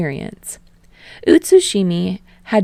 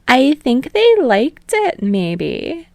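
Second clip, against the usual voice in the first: teasing host; 9 semitones higher, 5 dB louder, slower than usual. Acted.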